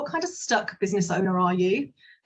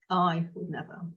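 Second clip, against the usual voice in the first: "I would never" dropped low and a little softer; low, soft intonation; 3 semitones lower, 3 dB quieter than usual. Shown as a demonstration. Coach demoing self-questioning prompt, said low and soft. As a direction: sink it down low and quiet. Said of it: "in a different intonation of voice".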